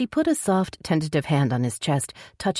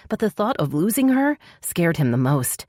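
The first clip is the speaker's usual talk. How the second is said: ordinary delivery, nothing marked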